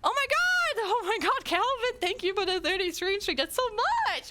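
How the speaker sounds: high, excited amazement; high-pitched, thrilled disbelief; astonished thanks. Real, not acted. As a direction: gush with real surprise. Thanking for a surprise gift.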